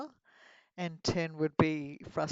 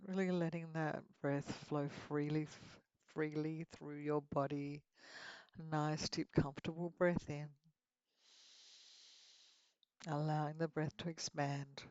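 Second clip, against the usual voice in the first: guided meditation; 6 dB quieter than usual. Acted. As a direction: lead them gently into relaxation.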